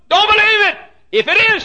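high, very loud command, easing slightly after; shouted exhortation. Real, not acted. Preacher answering the church member's objection, shouted exhortation. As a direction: roar the command over the objection.

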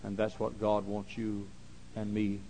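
ordinary delivery

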